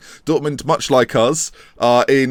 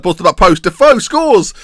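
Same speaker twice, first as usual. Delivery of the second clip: commentary rising to a loud, high shout; excited goal call; 7 semitones higher, 7 dB louder than usual. Real, not acted. Live commentary as a goal goes in.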